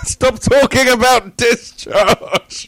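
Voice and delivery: high, slow, loud plea; exasperated shout, laughing; laughing protest. Real, not acted. Friend begs him to stop.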